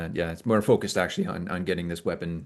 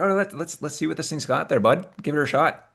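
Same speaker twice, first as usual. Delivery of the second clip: higher-pitched in-character voice; Bevan's voice; 8 semitones higher than usual. Acted. Character hands the weapon over to be tried, high-pitched. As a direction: pitch it up, offering a buddy first go. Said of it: "and be like".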